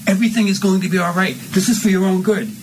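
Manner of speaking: falsely soothing, mean undertone; mean reassurance. Shown as a demonstration. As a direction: comfort her with a mean edge. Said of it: "you say this kind of meanly".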